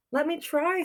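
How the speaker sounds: high eager little mouse; small mouse voice. Acted. Lester the mouse begs to try, high and eager. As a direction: pipe up like a tiny eager mouse.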